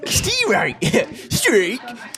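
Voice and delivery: high silly voice. Pretend. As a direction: squeak the name out. Echo in high voice.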